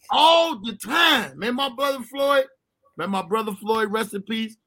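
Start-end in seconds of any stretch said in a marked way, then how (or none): none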